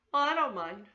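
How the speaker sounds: loud, bright answer; a frog talking back to a witch; cheeky retort. Acted. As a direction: toss the reply back boldly.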